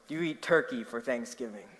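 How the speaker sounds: usual speaking voice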